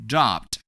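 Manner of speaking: "Dropped" as a demo of ED said as D; one syllable "Dropped", meant to end in D; ED pronounced as D. Shown as a demonstration. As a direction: model "Dropped" with the ED as a single D sound. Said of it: "we pronounce E D as D".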